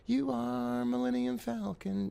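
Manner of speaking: high, slow put-on movie voice; Star Wars quote voice. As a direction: deliver it like a space movie line. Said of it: "A little Star Wars quotations".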